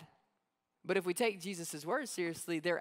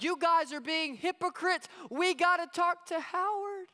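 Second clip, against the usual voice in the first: put-on high, loud child's accusation; a little boy scolding his parents; indignant kid; 9 semitones higher, 3 dB louder than usual. Kid accusing his parents of being hypocrites. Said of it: "the kid's like".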